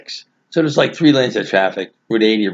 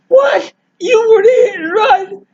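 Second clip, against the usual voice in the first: shouted high in total shock, slower on the last line; shocked disbelief; 4 dB louder, slower than usual. Retold or imitated. Hearing that brother was the hit and run, shouted shock. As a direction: yell it, stunned he was the culprit. Learns brother caused the crash.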